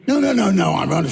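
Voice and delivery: high-pitched rush of protest; flustered denial. Retold or imitated. Director to actor correcting a misunderstanding, flustered denial. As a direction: hastily deny it, starting high and falling. Comic retold hasty denial of interest.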